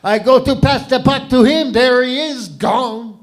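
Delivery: loud, high French Canadian hockey player; hammed-up French Canadian telling a game story; French Canadian accent. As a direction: boom it out like an excited Québécois player. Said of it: "French Canadian guy going".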